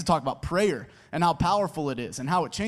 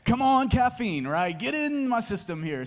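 mock plea to caffeine; 5 semitones higher than usual. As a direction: jokingly cheer the caffeine on, pitched up. Jokingly urging caffeine to work.

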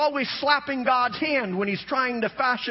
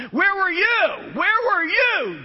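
slow, much higher shouted where were you; God's booming question; 9 semitones higher, 5 dB louder, slower than usual. Acted. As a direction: hurl the question down as God. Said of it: "he takes two chapters asking".

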